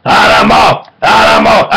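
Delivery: wild shouted Animal yell, pitch jumping; rough wild yell of the name; Animal impression. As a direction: bellow the name like the drummer Muppet. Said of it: "Frank Oz is the voice of".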